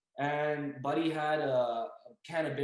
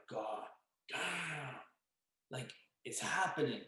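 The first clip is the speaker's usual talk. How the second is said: hushed dread; 2 semitones lower, 8 dB quieter, slower than usual. Retold or imitated. Dread as wolves appear.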